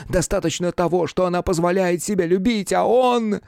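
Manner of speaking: high-pitched, impassioned torrent; fervent outburst. A narrator's reading. Lovestruck mathematician defending his beloved, fervent and overwrought. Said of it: "он заговорил пылко и быстро, аж задыхаясь".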